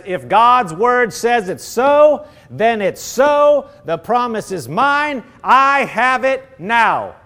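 slow, emphatic declaration of faith; faith declaring. Pretend. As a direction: lean on every word as settled truth. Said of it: "real faith, says".

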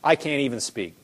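mildly acted, subdued defeat; acted overwhelmed. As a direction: let it sink, as if weighed down. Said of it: "you feel the pressure so much".

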